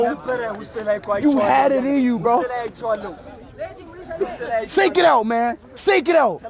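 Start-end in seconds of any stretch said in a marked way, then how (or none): 0.0-2.4 slow consolation. Real, not acted.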